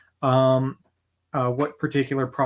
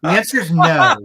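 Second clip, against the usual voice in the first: firm refusal; 8 semitones higher, 9 dB louder than usual. Pretend.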